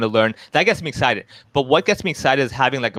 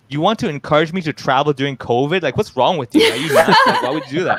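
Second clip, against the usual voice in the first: mock indignant disbelief; 6 semitones higher than usual. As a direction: protest as if the suggestion is madness, laughter creeping in. Brain's comic indignant reply to heckler.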